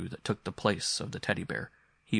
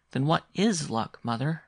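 put-on higher, slow child's question; questioning boy; 6 semitones higher, 4 dB louder than usual. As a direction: ask like a curious boy, leaning on "is". Boy questions his mother.

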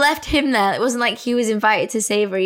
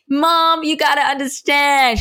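loud, higher mock American drawl; American accent, pitched up and pushed louder; drunk American accent; 5 semitones higher, 3 dB louder, slower than usual. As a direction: put on a tipsy American accent to mum. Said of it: "go all out America".

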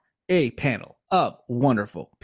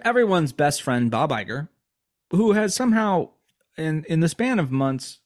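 usual speaking voice

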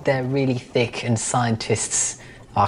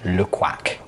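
French duck accent; 6 semitones lower than usual. Shown as a demonstration. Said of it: "I think French ducks say".

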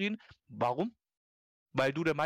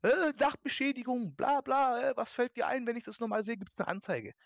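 much higher, shrill-pitched mock yelling; screaming conductor; 10 semitones higher than usual. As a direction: play the conductor bawling out the boy. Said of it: "der schreit den richtig an".

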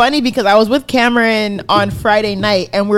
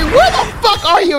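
higher pitched mock demand from an outraged friend; 10 semitones higher, 3 dB louder than usual. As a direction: play the friend who can't believe it, voice jumping up. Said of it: "he was pissed".

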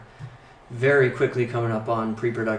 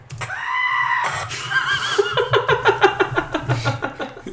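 goat scream; 5 dB louder than usual. Acted. Screaming goat clip imitated.

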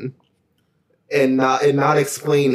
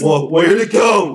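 baffled shout; 4 semitones higher, 5 dB louder than usual. Retold or imitated. Imagined owner baffled.

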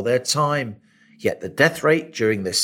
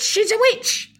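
high mob cry repeated; angry mob leader; slower than usual. Pretend. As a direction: shout the accusation up high. Mock witch-hunt mob cry.

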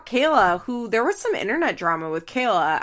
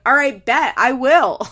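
louder, higher mock challenge; retold screaming match, half-shouted; mock yell; 5 semitones higher, 8 dB louder than usual. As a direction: yell it back like a dare, hammed up. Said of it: "And Stephanie's like".

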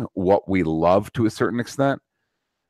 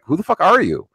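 put-on much higher, scornful disbelief at the kids; incredulous mocking rant; 9 semitones higher than usual. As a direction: pitch it way up, sneering who-do-you-think-you-are. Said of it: "It's like".

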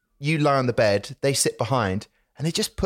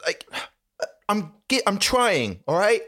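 higher, pleading defensiveness; exasperated protest; 7 semitones higher than usual. Real, not acted. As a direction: insist you're trying, a little wounded. Defends himself, pitch jumps up.

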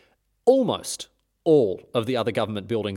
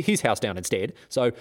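ordinary delivery

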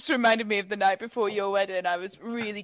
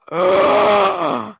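a sharp loud gasp of realisation; 8 dB louder than usual. Retold or imitated. Friend gasps retelling her hail revelation, loud sharp gasp. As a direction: gasp hard as it dawns on you.

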